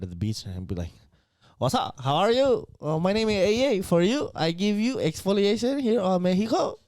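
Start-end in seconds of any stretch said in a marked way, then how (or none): 1.6-6.8 put-on Mexican accent. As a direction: hawk it like a beach vendor. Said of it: "a very racist Mexican accent".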